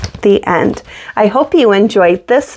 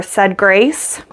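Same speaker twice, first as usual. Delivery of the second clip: plain reading voice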